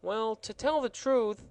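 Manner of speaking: hesitate, unsure; perplexed gardener voice. Acted. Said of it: "in a perplexed tone of voice".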